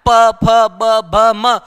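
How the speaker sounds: प फ ब भ म said loud with both lips pressed; demonstrating lip consonants clearly; lip sounds demo. Shown as a demonstration. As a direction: pop each of प फ ब भ म off closed lips. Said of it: "क्या इसका उच्चारण आप दोनों ओठों को स्पर्श किए बिना".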